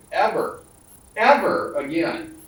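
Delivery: retold vow, hit "ever" hard both times, the second drawn out; emphatic. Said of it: "told that rather emphatically".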